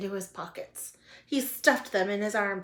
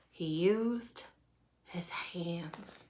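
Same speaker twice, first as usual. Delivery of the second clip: dropping to a soft whisper; hushed reveal; 3 semitones lower, 7 dB quieter, much slower than usual. A narrator's reading. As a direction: let the bad news fade into a whisper.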